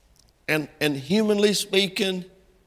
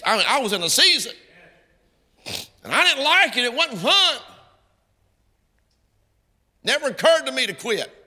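full-force preaching; 10 semitones higher than usual. Real, not acted. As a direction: preach it hard, climbing with each line.

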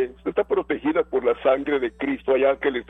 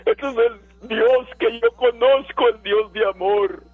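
higher, fervent proclamation; exultant pulpit declaration, pitched up; impassioned preaching; 5 semitones higher than usual. Real, not acted. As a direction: proclaim God's love to the congregation, voice lifted. Preacher exalts God at full force.

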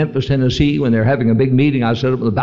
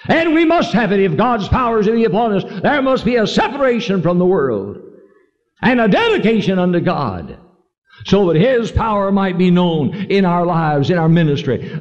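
higher, emphatic call to stand firm; rally the congregation; preaching exhortation; 5 semitones higher than usual. Acted.

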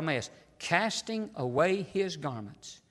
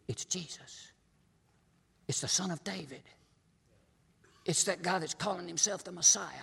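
much softer, hushed aside; hushed crowd talk; 2 semitones higher, 6 dB quieter than usual. Acted. Voices what the blind man hears.

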